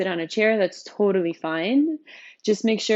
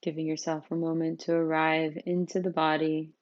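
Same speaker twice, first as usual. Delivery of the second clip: softer, unhurried voice, settling listeners into the body; guided meditation; 4 dB quieter than usual. Real, not acted. Teacher leading newcomers through a seated practice, calm meditation guidance.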